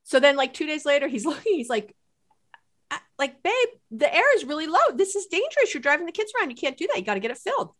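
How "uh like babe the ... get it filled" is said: raised, higher voice as the worried husband; husband's warning. Retold or imitated. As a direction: voice the husband fussing over the tire. Husband voiced warning about tire.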